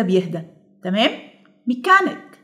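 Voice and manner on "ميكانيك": ميكانيك with كان lifted high; stress on middle syllable. Shown as a demonstration. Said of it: "عايزه اركز على كان".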